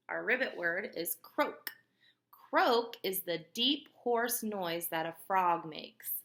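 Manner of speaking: usual speaking voice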